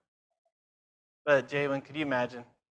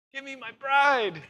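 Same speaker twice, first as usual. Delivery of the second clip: a higher, slow, longing plea as the Lord; voicing the Lord; 10 semitones higher than usual. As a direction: plead as the bridegroom calling for his bride. Voicing the Lord's demand.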